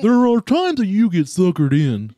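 nasal school counselor singsong; sing-song Mr. Mackey impression; Mister Mackey voice. Shown as a demonstration. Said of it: "Mister Mackey singing".

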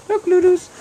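high squeal at the dog; squeaky pet voice. Real, not acted. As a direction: squeak it out to the pup. Calling the dog excitedly.

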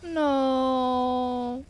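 long drawn-out wail of no; grieving no. Real, not acted. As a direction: draw the word out in disbelief. Reacting to a character's death.

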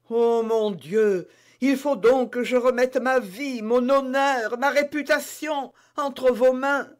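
surrender her life into the maid's hands; anguished queen. Acted. Reine se livre, effrayée. (reine angoissée, suppliante)

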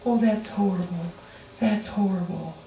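put-on baby them with sugary words; mock-tender coddling of a friend. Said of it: "we sit and we go".

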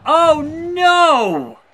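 high, loud cry of alarm; shocked shout. Real, not acted. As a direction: yell it as the move lands. Sudden turn in the match.

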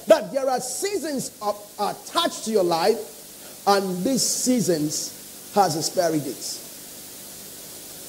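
quiet emphatic sermon point; slowing, softening. Acted. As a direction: let it sink in slowly. Preacher slows and softens.

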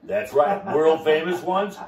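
upbeat pitchman selling limos to listeners; sales pitch. Acted. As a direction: hype it like a TV ad for the fleet. Pitches his limo fleet for sale.

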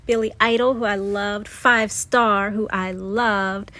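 ordinary talk

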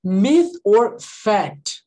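slow, drawn-out, evenly spaced words; slow title announcement. Shown as a demonstration. Teacher introducing the game to students, slow and deliberate. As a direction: announce it slowly, word by word.

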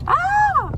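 very high, loud mock shriek; hammed-up scared cry; dramatic shriek. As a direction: shriek like a frantic pet owner. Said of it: "picked him up so dramatically and was like".

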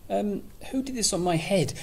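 high, eager question; excited. Acted. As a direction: ask it bursting with hope. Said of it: "he asked excitedly".

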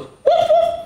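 high, loud playful woof; puppy bark. Acted. Teacher showing kids the puppy bark, high and loud. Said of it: "And he goes".

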